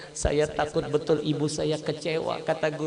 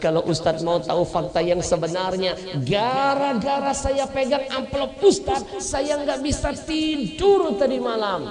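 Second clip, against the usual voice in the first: building loud and high; distressed confession; 14 semitones higher, 7 dB louder than usual. Acted. Doctor confesses to the ustadz, rising loud and high. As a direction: blurt out how troubled you were.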